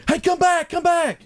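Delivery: very high mock holler; imitated yelling. Man on a bus top calling a driver back, imitated yell. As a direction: call after someone in a high, put-on yell. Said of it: "he is yelling at me".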